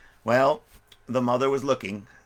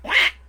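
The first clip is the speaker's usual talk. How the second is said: loud squawky quack; imitation duck call; 8 dB louder than usual. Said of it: "he quacked".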